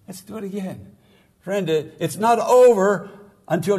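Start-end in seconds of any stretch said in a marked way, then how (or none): 0.1-0.9 same words said much softer; a quiet, tender echo of the first call; softer repeat. Retold or imitated. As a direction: bring it down gently the second time. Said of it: "Then I got up and I said".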